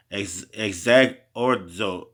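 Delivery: exagorzo stretched out slowly, syllable by syllable. Shown as a demonstration. Teaching the Greek word exagorzo slowly to listeners. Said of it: "let me say it slow".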